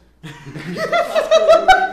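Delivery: shrieking burst of laughter; high howling laugh; loud laughter. Real, not acted. Friends joking crudely.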